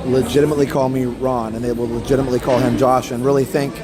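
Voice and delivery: usual speaking voice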